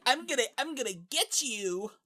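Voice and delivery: high, nasal-free cartoon villain squeak; squeaky villain voice. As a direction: play him like a Team Rocket villain. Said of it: "that's what he sounds like".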